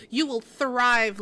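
You will thrive slow and loud; emphatic encouragement. Real, not acted. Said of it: "encouraging chatter emphatically".

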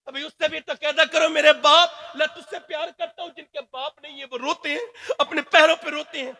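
high, loud, shouted sermon building to a roar; fiery preaching. Acted. Preacher urging the crowd to honour their fathers, roaring. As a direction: thunder at the crowd like a street preacher. Preacher rises to full force.

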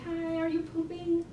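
high, drawn-out hostess question; mock sweet greeting. Chef greets a restaurant guest, mock sweet. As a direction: chirp it high and slowly.

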